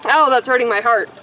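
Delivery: ordinary talk, nothing marked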